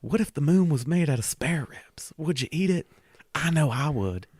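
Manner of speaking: high playful whisper. Pretend. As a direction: whisper it like a secret. Joking whispered aside.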